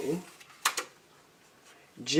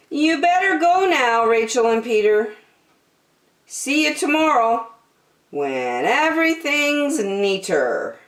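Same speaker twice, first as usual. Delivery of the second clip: put-on pleading little girl; 8 semitones higher than usual. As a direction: beg for a bit more time. Jillian sends friends home.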